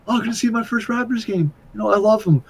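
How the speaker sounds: высокий наивный восторг, чуть тише; mock excited self. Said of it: "booked a basketball game".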